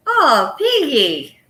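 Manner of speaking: loud, high, drawn-out exclamation; exclaiming to a friend. Real, not acted. Host reacting to friend Piggy, loud drawn-out exclamation. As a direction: cry out the name, stretching it long.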